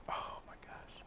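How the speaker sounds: barely audible awed whisper; stunned whisper. Retold or imitated. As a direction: whisper it to yourself in disbelief. Stunned by the stranger's message.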